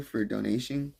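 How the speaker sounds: talking through a yawn. Real, not acted. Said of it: "I fucking got myself yawning".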